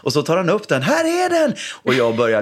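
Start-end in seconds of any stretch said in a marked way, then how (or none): 0.8-1.5 put-on high, proud child voice; triumphant kid. Son showing the shoe, high proud child voice. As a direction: hand it over like a victory.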